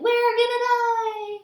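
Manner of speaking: high, slow, loud wail of panic; panicked yell. A narrator's reading. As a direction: passengers screaming in terror. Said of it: "running around in circles yelling".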